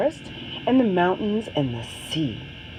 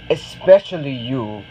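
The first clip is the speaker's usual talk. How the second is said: lower, smiling Especially you; with a smile; 6 semitones lower than usual. Acted. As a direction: reassure the girl kindly. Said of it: "says the librarian with a smile".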